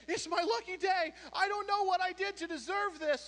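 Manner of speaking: overjoyed golfer. Acted. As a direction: crow it like the luckiest man alive. Man sees gorgeous woman.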